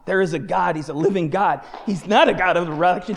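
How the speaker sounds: ordinary delivery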